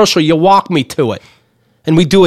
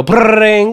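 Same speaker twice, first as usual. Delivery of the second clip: imitated phone ring; 4 semitones higher than usual. The delivery company calling, mimicked ringing phone.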